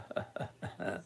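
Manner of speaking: very soft, breath-only chuckle; low chuckle. Real, not acted. Trader reading a viewer's comment about his accent, low chuckle. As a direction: chuckle quietly to yourself.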